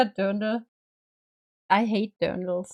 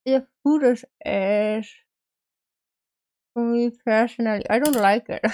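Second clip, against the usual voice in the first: talking around a toothbrush; brush in mouth. Real, not acted. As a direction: speak with a toothbrush in your mouth. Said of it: "I'm talking with a brush in my mouth".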